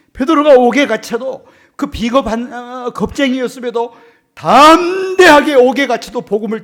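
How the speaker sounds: high, loud impassioned sermon delivery; fiery preaching. Real, not acted. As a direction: preach it to the back row. Preaching at full force. (높고 큰 목소리로 열정적인 설교)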